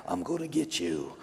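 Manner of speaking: low, soft, menacing phone voice; creepy caller. Retold or imitated. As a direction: growl it quietly like a stalker on the line. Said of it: "The guy's like".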